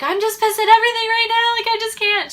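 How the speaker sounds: sudden high, loud angry venting; angry outburst. Retold or imitated. To her mom on the phone, a loud angry outburst. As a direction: blurt out fury at everything.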